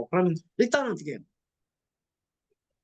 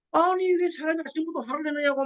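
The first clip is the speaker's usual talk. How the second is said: mock retort; 7 semitones higher than usual. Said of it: "막 달라드는 거야".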